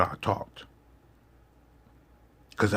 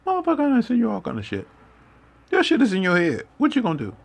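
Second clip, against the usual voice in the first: high, fast, exasperated comeback; exasperated father; 13 semitones higher, 3 dB louder, faster than usual. Retold or imitated. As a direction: snap back like a fed-up dad. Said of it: "He was like".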